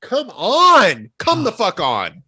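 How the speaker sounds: high, loud, incredulous protest; exasperated outburst. Real, not acted. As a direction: plead loudly in disbelief, pitch jumping up. Arguing over a disliked film.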